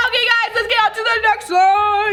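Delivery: excited announcement. Real, not acted. Telling viewers about next slime, excited high announcement.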